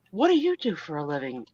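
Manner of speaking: low, soft quoted voice; low hushed quote of the question; asking about a job. Retold or imitated. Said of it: "I was like".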